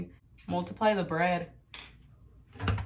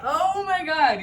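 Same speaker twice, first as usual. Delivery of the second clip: excited; 8 semitones higher, 4 dB louder, slower than usual. Retold or imitated. Her reaction to his flex.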